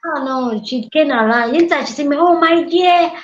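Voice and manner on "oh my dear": put-on higher and louder, doting old mother; fond greeting. Mother-in-law fondly greets her daughter-in-law, higher and louder.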